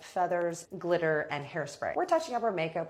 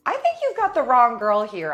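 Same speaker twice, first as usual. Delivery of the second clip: louder, higher push back; firm loud retort; 7 semitones higher, 10 dB louder than usual. Retold or imitated. To the accusing dancer, a loud higher firm denial. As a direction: set her straight, loud and firm.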